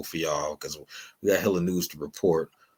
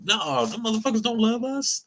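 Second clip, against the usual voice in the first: high indignant retort; 13 semitones higher than usual. Real, not acted. Rebuttal in raised voice.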